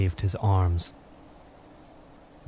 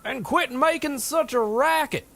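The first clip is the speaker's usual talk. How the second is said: shouting. A narrator's reading. Charlie shouting up to the giant. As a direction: yell it across the peaks. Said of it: "Charlie said".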